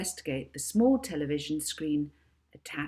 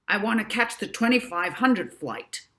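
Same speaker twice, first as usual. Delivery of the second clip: loud, higher forceful demand; 5 semitones higher, 5 dB louder than usual. A narrator's reading. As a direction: insist on it forcefully. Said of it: "demanded the professor".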